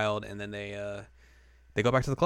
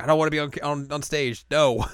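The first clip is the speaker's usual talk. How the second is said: raised, louder mock protest; Nodoka refusing; 5 semitones higher, 5 dB louder than usual. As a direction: build to a loud refusal on the final word. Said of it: "but Nodoka's like".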